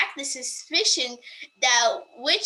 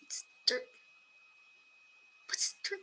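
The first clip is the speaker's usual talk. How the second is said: whisper; 12 dB quieter than usual. Real, not acted. Baffled aside while dictating.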